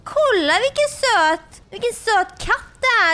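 cooing at a cute cat. Real, not acted.

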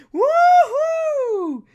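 loud, very high wouhou cheer; high whoop. Real, not acted. Celebrating on camera, a loud high whoop. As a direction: let out a huge whoop.

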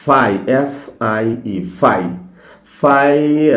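ফাই spelled slowly as এফ আই ই, then said clearly; slow spelling of ফাই, then the word itself; ফাই with a long ai, spelled out first; correct pronunciation. Shown as a demonstration. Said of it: "ভুল".

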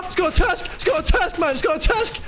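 taunting. Real, not acted. Taunting enemy player.

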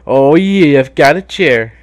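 loud gleeful cheer; excited. Real, not acted. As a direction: crow about the find. Excited at finding a weapon.

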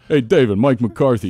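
loud, high imitation of a Pittsburgh accent. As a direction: mimic his Pittsburgh accent, big and high. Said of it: "in his Pittsburgh accent".

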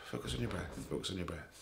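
very soft, hushed breathing cue; guided meditation. Real, not acted. Leads a breathing exercise.

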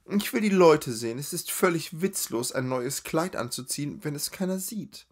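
high, gushing, chatty lady's voice; gushing society lady. Acted. A spoilt lady gossiping with her runaway friend, high gushing chatter.

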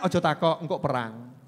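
ordinary talk

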